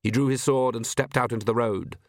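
usual speaking voice

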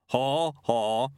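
lightly voiced yeti saying a laugh; spoken laugh. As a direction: say the laugh as words, without laughing. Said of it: "it said it rather than laughed".

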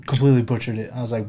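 ordinary talk, nothing marked

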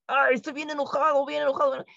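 acting angry. A hint of a mock angry person fuming. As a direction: ham up being really angry. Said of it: "así".

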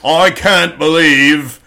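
slow, loud, hammed-up delivery; Ken's staged line. Host mocks Ken walking into the room, slow staged disbelief. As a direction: put on a laboured, scripted voice. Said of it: "they make Ken walk in and go".

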